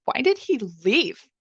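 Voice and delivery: rising upset, pitch climbing sharply on the repeat; fretful, worked-up repeat of why did he leave; getting upset. Retold or imitated. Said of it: "I started to kinda get upset".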